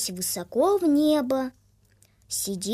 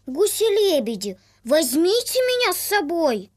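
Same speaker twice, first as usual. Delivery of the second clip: put-on eager little boy; 5 semitones higher, 5 dB louder than usual. Little boy calls to the geese.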